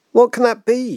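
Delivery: high, startled cry; alarmed schoolteacher crying out. A narrator's reading. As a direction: call out, jolted awake by a crash. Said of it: "she cried".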